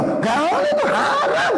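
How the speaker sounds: squeaky high imitation, laughing; a mocked high voice saying it is forbidden. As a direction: pitch it way up, mocking. Child or parent, high voice.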